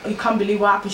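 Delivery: ordinary delivery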